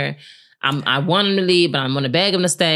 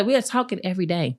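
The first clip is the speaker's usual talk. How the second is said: ordinary talk, nothing marked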